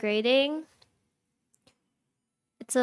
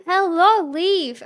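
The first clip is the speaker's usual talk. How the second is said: excited greeting; 7 semitones higher, 7 dB louder, slower than usual. Real, not acted. Greeting a returning viewer excitedly.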